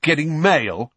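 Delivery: loud, challenging question; Fred, loud. A narrator's reading. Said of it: "said Fred loudly".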